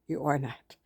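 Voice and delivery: soft repeat. Real, not acted. Reassuring a friend who feels ugly, softly repeated. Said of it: "to my friend out there that thinks she's fat and ugly".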